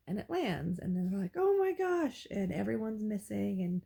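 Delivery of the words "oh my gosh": high, louder gasp of amazement; like a crowd stunned by a lucky shot; amazed onlookers. Retold or imitated. Crowd watching her penny land, high amazed gasp.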